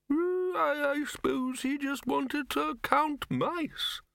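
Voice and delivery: put-on very high, slow, quavering old man; ancient wizard. Old wizard reminiscing from his wheelchair, doddery. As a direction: draw it out in a high old-codger voice.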